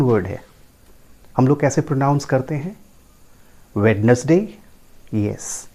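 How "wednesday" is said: Wednesday in three syllables, WED-nus-day; common mispronunciation. Shown as a demonstration. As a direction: sound the D in Wednesday. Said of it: "that is not the correct pronunciation". (Wednesday का गलत उच्चारण, वेड-नस-डे)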